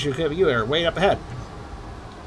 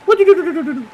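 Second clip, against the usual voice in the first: mock outrage; 10 semitones higher, 5 dB louder than usual. Real, not acted. Reacting to game, mock outrage.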